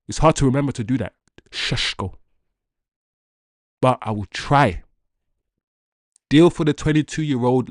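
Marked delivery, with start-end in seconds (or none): 1.5-2.2 "Šeško" with soft "sh" sounds, whispered under the breath; Slovenian pronunciation. Shown as a demonstration. Fan practising the striker's name properly, whispered "Šeško". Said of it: "if I'm gonna keep up the Šeško".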